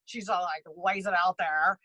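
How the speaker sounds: ordinary delivery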